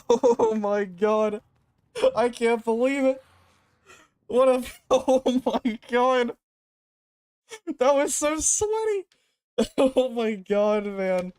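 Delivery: slow, laughing disbelief; giddy relief. Real, not acted. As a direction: laugh it out, stunned and happy. Laughing after the big win.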